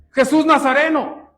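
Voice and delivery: acted shout of recognition; imitated fearful cry. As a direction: cry it out like a frightened demon. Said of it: "empezó a gritar de temor".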